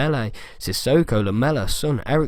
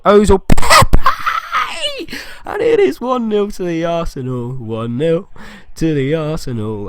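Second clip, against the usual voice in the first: much higher and louder, laughing as he yells; goal celebration shout; 6 semitones higher, 5 dB louder, slower than usual. Real, not acted. Gamer celebrating his team's goal to viewers, ecstatic shout.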